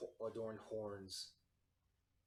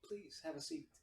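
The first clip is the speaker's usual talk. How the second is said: lightly voiced higher feminine host; soft feminine voice; 6 semitones higher than usual. Tiefling host offers guests a seat, soft feminine voice. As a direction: politely invite them in, higher-pitched. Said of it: "you hear this soft feminine voice".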